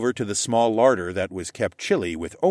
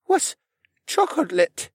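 high, puzzled character voice; curious Rylan; slower than usual. A narrator's reading. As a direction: ask it curiously, stumbling over the new word. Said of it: "he asked".

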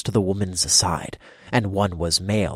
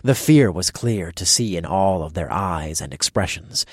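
ordinary delivery

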